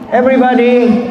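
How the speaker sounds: ordinary delivery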